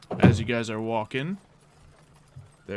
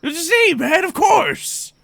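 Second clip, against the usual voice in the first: very high, loud protest; insistent prisoner cousin; 13 semitones higher, 10 dB louder than usual. Acted.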